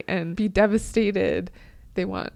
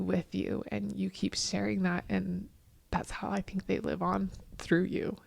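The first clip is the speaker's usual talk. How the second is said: whispering; 6 dB quieter than usual. Real, not acted. Tender remark on legacy.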